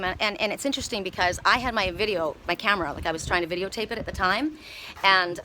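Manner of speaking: ordinary talk, nothing marked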